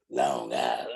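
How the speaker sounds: low and heavy, like a bass; mock deep voice. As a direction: imitate her deep order again. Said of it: "retelling her deep voice".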